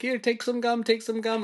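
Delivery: high-pitched imitation of an apologetic woman; mock flustered peace offering. Retold woman apologizing with gum.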